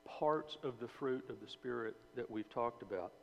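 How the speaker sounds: ordinary delivery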